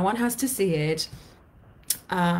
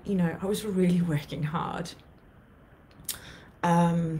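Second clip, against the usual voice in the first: ordinary talk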